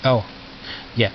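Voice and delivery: usual speaking voice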